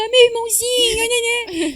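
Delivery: mock a whining sister; whiny mimimi. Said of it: "gosta de ficar de mimimi".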